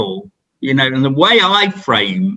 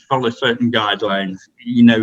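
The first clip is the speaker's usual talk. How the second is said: ordinary talk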